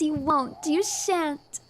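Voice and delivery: ordinary talk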